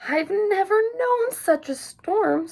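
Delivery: high, slow squeaky mother mouse; high squeaky mouse voice, slow and fretful. A narrator's reading. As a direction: squeak it out like a worried little mouse. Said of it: "squeaked Mother Mouse".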